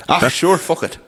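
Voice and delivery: high West Cork lilt on Ah, sure, fuck it; mock West Cork accent. Podcaster imitating the West Cork locals, a high lilting West Cork accent. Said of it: "they all say that".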